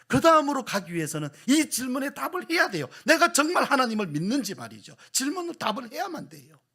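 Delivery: loud, high, impassioned sermon delivery; preaching at full force. Acted. Pastor pressing the congregation, loud and impassioned. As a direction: drive the point home to the pews.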